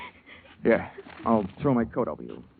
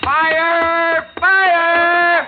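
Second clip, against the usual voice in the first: loud, high false alarm cry; shouting fire; 9 dB louder than usual. Acted. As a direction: yell it to flush him out of the room. Detective fakes a fire alarm.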